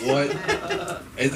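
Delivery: usual speaking voice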